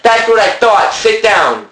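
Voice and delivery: loud, high, heated shouting; angry shouting. Acted. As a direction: yell back in a heated argument. Heated mock quarrel.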